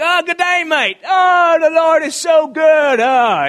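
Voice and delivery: high, booming Australian shout; exuberant Australian. Retold or imitated. Australian friend greeting the morning with praise, exuberant high shout. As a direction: belt out praise with loud Australian cheer. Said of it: "He was an Australian".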